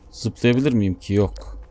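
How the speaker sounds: ordinary delivery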